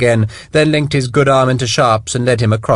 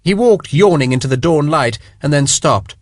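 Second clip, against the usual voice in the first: ordinary delivery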